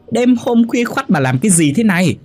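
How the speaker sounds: very high-pitched angry scolding; acted shrill outcry of exasperation; screeching outrage. A narrator's reading. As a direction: screech it like a fed-up wife. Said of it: "Mụ Hoa gào lên".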